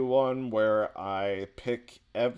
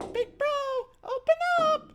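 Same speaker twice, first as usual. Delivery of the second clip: very high, loud squealing yell; screaming little sister; 5 dB louder, faster than usual. Acted. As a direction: shriek it like an obnoxious sister at the door.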